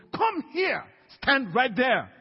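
high, loud order to a demon; commanding shout. Retold or imitated. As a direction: shout it like summoning someone to stand to attention.

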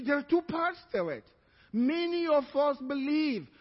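ordinary delivery

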